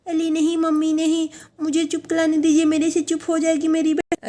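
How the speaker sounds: put-on high, lisping baby-talk child voice; little girl Amayra, pleading. Little girl begging mummy to let her hush her sister, high childlike pleading. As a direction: plead like a small child in pretend play.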